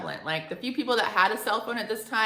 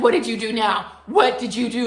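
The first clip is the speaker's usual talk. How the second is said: loud, raised-pitch interrogation; accusing mother; 4 semitones higher, 9 dB louder than usual. Retold or imitated. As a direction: imitate a mom demanding a confession. Mother interrogates daughter, acted quarrel.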